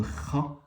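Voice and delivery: "Kha" with a rasped back-of-throat fricative; Arabic kha. Shown as a demonstration. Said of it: "le K H". (« Kha » avec un kh raclé, à l'arabe)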